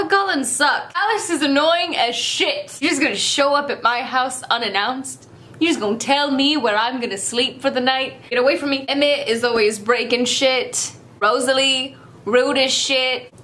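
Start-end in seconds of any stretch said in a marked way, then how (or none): none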